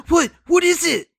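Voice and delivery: gasping what is it; mock gasp. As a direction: gasp then a startled what. Gasping at cliffhanger.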